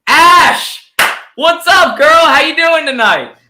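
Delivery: loud, high excited greeting; excited shout. Real, not acted. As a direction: greet her like a hype host. Hyping up guest's entrance.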